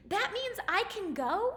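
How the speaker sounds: lightly voiced hopeful Cinderella; eager hope. A narrator's reading. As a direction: ask it with sudden hope. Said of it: "Cinderella cried".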